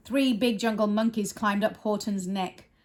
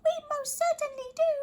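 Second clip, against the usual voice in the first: very high, fast squeaky little voice; tiny mayor voice; much faster than usual. Acted. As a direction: speak up as a tiny Who.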